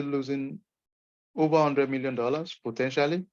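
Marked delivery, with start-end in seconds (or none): none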